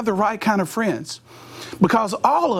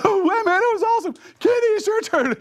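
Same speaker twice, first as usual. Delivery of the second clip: much higher, breathless excitement; thrilled friend. Retold or imitated. Mike surfacing from the pool, much higher and thrilled. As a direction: gush like a kid after a jump.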